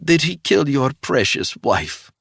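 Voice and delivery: high, taunting voice; wounded spy taunting the widower; sneering villainess. A narrator's reading. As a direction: twist the knife, mocking. Woman drops the act.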